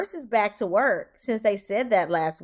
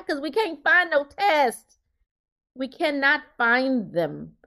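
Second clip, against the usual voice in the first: slow emphatic; 3 semitones higher, slower than usual. Real, not acted. Complaint about test shortage.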